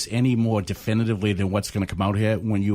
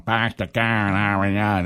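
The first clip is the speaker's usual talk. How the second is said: slow Hollywood Boston accent, dropped Rs; drawn-out Boston accent showpiece; slower than usual. Shown as a demonstration. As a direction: say "Park the car in Harvard Yard" as "Pahk the cah in Hahvahd Yahd". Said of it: "that's Hollywood for me".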